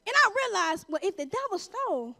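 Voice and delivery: ordinary delivery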